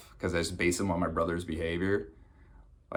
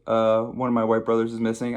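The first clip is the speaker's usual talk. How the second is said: ordinary talk, nothing marked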